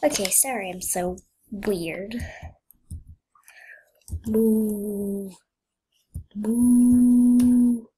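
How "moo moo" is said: drawn-out playful moo; a silly imitated moo; cow moo. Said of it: "I'm a cow".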